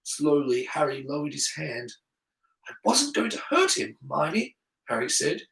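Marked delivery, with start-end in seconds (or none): 2.7-4.6 softened, high-pitched protest; young pupil explaining himself; subdued boy. A narrator's reading. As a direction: reassure a friend, easing off. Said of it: "his voice a little lower".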